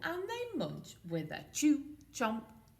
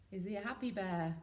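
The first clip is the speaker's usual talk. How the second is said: plain reading voice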